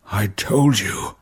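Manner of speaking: slow, faint words of a dying man; weak dying whisper. A narrator's reading. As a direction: struggle to get the words out, slowly. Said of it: "Wayland whispered".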